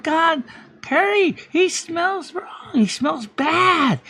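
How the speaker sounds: slow, high, tearful whine; a hammed-up high sobbing complaint; whiny mock crying. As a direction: drag it out like a sulking child. Acted whining complaint.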